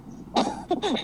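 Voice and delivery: high-pitched amused laugh. Real, not acted. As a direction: burst out laughing at your own story. Laughs at his own anecdote. (ऊँची आवाज़ में हँसी)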